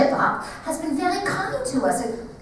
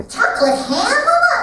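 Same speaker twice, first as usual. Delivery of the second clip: a startled high squeak of a question; the child can't believe what Mama is serving; shocked child; 13 semitones higher, 5 dB louder than usual. Acted. Child asking Mama in shock, high and surprised.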